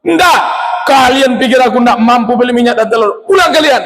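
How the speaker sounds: high, furious no to the bribe; pious man insulted by the offer; angry refusal. Retold or imitated. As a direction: chase them out with high outrage. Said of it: "Jangan marah".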